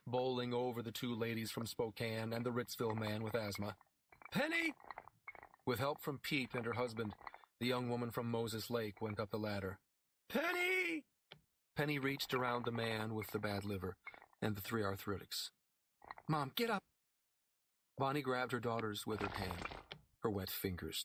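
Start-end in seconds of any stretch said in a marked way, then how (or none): 4.3-4.7 much higher scream of the name; falling and calling her daughter; cry out name. A narrator's reading. Calling out as she falls. 10.3-11.0 much higher scream of the name; falling and calling her daughter; cry out name. A narrator's reading. 16.3-16.8 higher, worried call; girl urging her fallen mom; urgent call. A narrator's reading. Girl calls to fallen mother.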